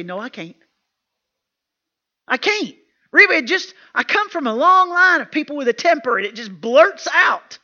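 acting out a loud, high-pitched protest; retold protest. Woman pushing back at the speaker, mock protest. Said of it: "women really look at me and say".

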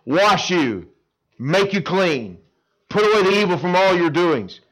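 slow, weighty Make you clean; emphatic. Shown as a demonstration. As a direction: underline every word. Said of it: "to emphasize".

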